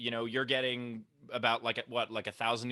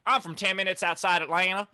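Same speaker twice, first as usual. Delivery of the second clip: higher-pitched, twangy country-accent imitation; 6 semitones higher, 4 dB louder than usual. People living just outside Atlanta, imitated country accent. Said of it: "that's the incest accent?".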